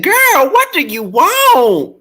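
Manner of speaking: high, loud, drawn-out exasperation; hammed-up fed-up daughter; retold annoyance. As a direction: act out snapping at mama, stretched out. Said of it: "she was getting on my nerves".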